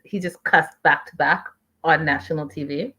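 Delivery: usual speaking voice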